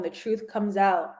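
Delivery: usual speaking voice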